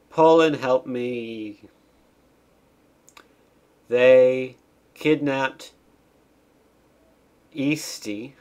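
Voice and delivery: slow, drawn-out loud plea; hammed-up cutscene distress call; mock plea for help. Cutscene plea read aloud.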